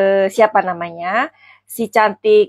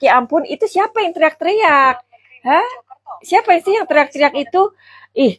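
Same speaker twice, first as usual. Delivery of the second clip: ordinary talk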